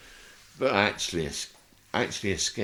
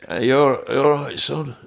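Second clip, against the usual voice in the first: much louder, higher gruff greeting; imitating Ricky; 8 semitones higher, 11 dB louder than usual. Ricky Tomlinson greeting him at the bed. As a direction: boom a friendly greeting. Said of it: "Ricky asks".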